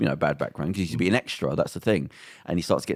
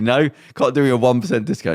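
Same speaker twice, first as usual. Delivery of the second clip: louder, higher sarcastic retort; mock sarcastic; 5 semitones higher, 6 dB louder than usual. Customer haggling with shop woman, mock sarcastic. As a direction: push the sarcasm as you retell it. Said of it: "he was sarcastically".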